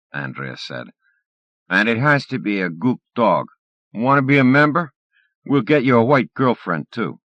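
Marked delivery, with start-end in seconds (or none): 1.7-7.2 taunting bully. Acted.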